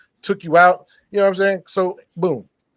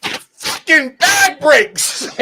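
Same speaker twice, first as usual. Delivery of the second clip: high, drawn-out words through laughter; slow, squeezed laughing exclamation; laughing punchline; 9 semitones higher, much slower than usual. Real, not acted. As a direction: crack up while delivering the disaster. Story punchline, breaking into laughter.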